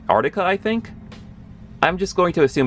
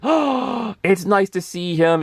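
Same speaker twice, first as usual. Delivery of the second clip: high, gushing fanboy delight; going crazy; 8 semitones higher, slower than usual. Retold or imitated. Fan reliving Omega Red cameo, going crazy with excitement. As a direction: re-enact excitement, starting way up high. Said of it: "I went crazy".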